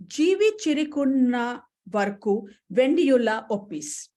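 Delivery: Malayalam title said in a Bengali accent. Shown as a demonstration. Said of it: "more or less in my Bengali accent".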